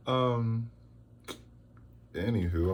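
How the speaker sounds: ordinary talk, nothing marked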